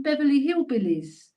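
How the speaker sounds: ordinary delivery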